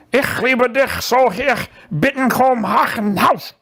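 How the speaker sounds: slow, harsh mock German. Joking about German, slow mock German. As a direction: ham up a German accent. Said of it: "but it's in French now".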